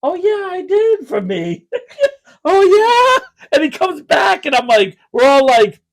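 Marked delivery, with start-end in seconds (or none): 0.0-1.7 high, over-agreeable dad impression; imitated sheepish tough guy; mock badass backing down. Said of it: "Oh, Mister Badass walking to the counter". 2.5-3.5 high, loud over-agreement; hammed-up "yes" in a raised pitch; mock badass backing down. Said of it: "Oh, Mister Badass walking to the counter".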